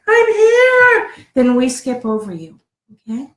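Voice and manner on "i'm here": high and loud "I'm here"; called out loud. Shown as a demonstration. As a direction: sing out "I'm here" brightly like a roll call. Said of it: "you don't say".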